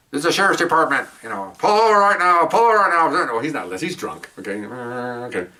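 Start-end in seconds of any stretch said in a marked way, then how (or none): 0.1-3.3 bullhorn order. Retold or imitated. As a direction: bark it like over a car loudspeaker. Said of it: "you can hear the bump sticker".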